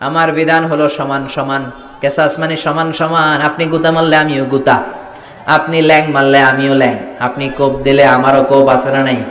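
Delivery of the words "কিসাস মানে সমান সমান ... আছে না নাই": ordinary talk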